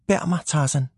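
lightly voiced pleading Jane; Jane's plea. A narrator's reading. Jane asks Tarzan to carry her, pleading.

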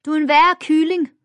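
high, loud jeering shout; shouted taunt. A narrator's reading. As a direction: yell it like a schoolyard bully. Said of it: "råbte Amalie".

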